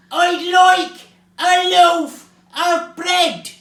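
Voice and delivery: very loud, slow, high bellow; shouting. A narrator's reading. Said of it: "Mister Noisy shouted".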